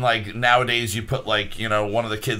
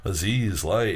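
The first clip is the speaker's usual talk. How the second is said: low, understated mimicry of a shouted line; offhand, toned-down mock repeat; mocking echo; 4 semitones lower, 4 dB quieter, slower than usual. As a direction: parrot the command back under your breath. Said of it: "just kinda, like, mocks him".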